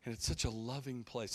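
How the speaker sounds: mock the gushing pastor, soft and sweet; syrupy preacher. Mocking the Seattle host describing his retreat, soft syrupy voice. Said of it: "That's how he's talking".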